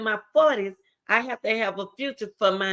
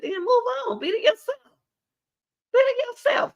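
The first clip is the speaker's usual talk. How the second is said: suddenly much higher, pressing the point home; high exhortation. Real, not acted. Speaker urging young women to drop negative friends, in a sudden high-pitched exhortation. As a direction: lift the voice way up to urge them.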